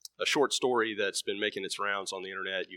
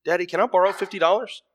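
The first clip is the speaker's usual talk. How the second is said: put-on high, louder little boy asking; small boy's voice; 10 semitones higher, 8 dB louder than usual. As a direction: put on a child's voice and ask hopefully. Son asks dad for money.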